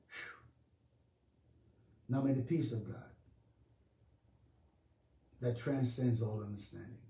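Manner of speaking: low, soft, measured blessing; hushed pastoral closing prayer; benediction. Real, not acted. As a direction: pray over them quietly, with long pauses.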